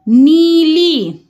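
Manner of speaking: नीली very slow, both ई drawn out; slow syllable demo. Shown as a demonstration. Teacher slowly sounding out नीली. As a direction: stretch नी and ली in नीली. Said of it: "नी कैसे लिखाएगा".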